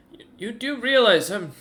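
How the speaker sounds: put-on a flustered kid stumbling over his words; nervous kid. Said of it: "the kid ... goes".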